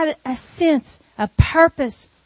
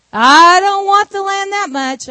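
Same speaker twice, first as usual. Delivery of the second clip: emphatic refusal; 4 semitones higher, 6 dB louder than usual. Retold or imitated. Relative refusing Ruth's price.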